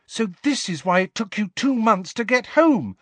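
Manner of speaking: high-pitched agitated realisation; distraught, pitched high; distracted. A narrator's reading. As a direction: think aloud, upset and distracted. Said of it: "said Hermione distractedly".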